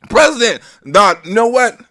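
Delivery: ordinary talk, nothing marked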